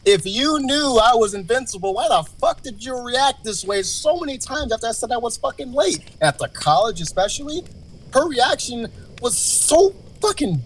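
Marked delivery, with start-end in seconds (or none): 0.1-6.0 high-pitched exasperated challenge; indignant questioning. Retold or imitated. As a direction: protest as the wronged boyfriend. Voicing indignant thought of the boyfriend.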